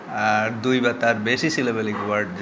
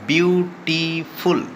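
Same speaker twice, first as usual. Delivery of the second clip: Beautiful slowly split into three separate beats; syllable by syllable; 5 semitones higher, much slower than usual. Shown as a demonstration. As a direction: stretch each syllable of beautiful, higher pitched. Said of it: "এখানে ট্রাইসিলেবলিক word এটা". (Beautiful শব্দটি ধীরে তিন সিলেবলে ভেঙে)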